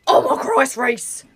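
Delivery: loud urgent cry down the phone; panicked shout. Acted. Friend panics while calling Reese, loud alarmed cry. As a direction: grab his attention, alarmed.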